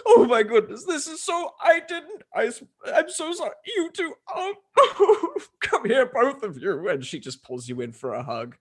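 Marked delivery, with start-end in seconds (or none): 0.1-6.9 high, breaking half-laughing, half-crying babble; tearful gushing. Acted. Haj kissing both sisters, gushing in high weepy joy. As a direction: gush through happy sobs, words tumbling and cut off. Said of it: "starts laying kisses on both of you, going".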